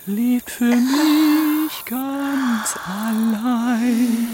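ordinary talk

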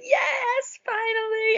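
very high, giddy cheer; retold high-pitched outburst of excitement; excited squeal. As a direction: squeal it like a fan at last rewarded. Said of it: "I was so excited".

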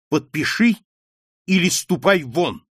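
stern command. Acted. Mother ordering her daughter to sign or leave, stern. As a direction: bark the order, no room to argue.

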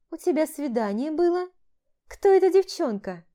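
jealous woman. Acted. Vlada jealous questioning.

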